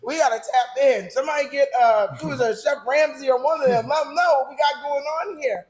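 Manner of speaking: hyped-up and loud, pitched high; raving about the food with big energy; excited. Real, not acted. Raves loudly about the food.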